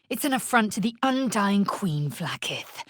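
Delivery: usual speaking voice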